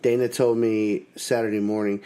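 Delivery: normal talking voice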